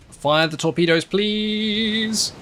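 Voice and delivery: plead, drawing out a high PLEASE; urgent plea climbing into a long high wail; pleading command. Real, not acted. Urging own ship to fire torpedoes.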